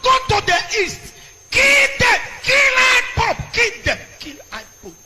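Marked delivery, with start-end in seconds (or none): 0.0-2.2 shouted order to kill, much higher and louder; soldiers ordered to kill. Retold or imitated. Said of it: "They say". 2.4-3.5 yelled high and loud, "kill I pope" hammered; shouted kill order. Retold or imitated. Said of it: "They say".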